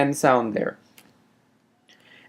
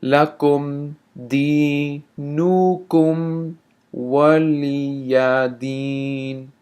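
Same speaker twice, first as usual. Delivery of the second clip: teacher slows the verse down for students; slow recitation; much slower than usual. Shown as a demonstration. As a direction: say it slowly, word by word. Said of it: "Let me say that slowly".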